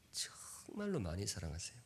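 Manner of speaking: 정말로 whispered, breath only. Shown as a demonstration. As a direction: breathe the line out as a secret. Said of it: "repeated as whisper".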